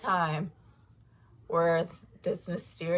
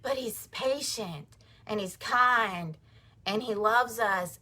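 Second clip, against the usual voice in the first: normal talking voice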